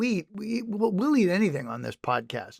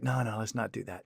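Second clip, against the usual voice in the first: soft, low warning; quiet pleading chest voice; 7 semitones lower, 12 dB quieter than usual. Retold or imitated. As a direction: beg softly to stop. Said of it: "my chest is like".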